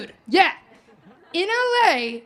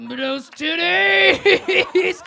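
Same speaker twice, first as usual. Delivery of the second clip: mock lusty shout; 2 semitones higher, 5 dB louder, much slower than usual. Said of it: "I'm like".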